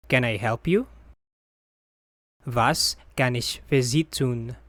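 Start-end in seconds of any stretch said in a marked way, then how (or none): none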